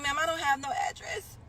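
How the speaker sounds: high, pleading put-on voice; pitiful whine to the caseworker; pitiful beggar voice. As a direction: play the helpless caller. Retelling call, acting pitiful.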